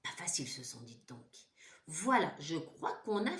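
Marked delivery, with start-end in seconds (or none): 0.0-1.5 breathy whispered aside. Real, not acted. Teacher to class, a whispered aside. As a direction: whisper it as a confidence.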